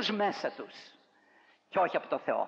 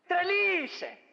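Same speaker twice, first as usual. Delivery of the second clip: high, mocking dismissal; the brothers scoffing at the servant girl; scornful brothers; 11 semitones higher, 4 dB louder, slower than usual. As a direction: brush her off as crazy. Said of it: "Ετούτοι είπαν".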